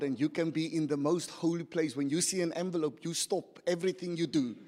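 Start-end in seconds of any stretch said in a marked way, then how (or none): none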